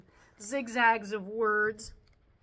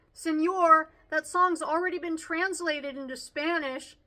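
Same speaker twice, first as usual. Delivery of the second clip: higher, loud tattling classmate; obnoxious kid; 5 semitones higher, 5 dB louder than usual. A narrator's reading. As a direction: pipe up like a know-it-all pupil. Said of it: "He's the obnoxious kid".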